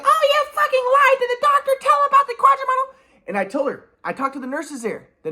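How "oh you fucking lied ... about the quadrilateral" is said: much higher shrill-free mom voice, yelling; mocking mom. Imitating his mom raging on the phone, much higher. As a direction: mimic mom screaming at him. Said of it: "she's like".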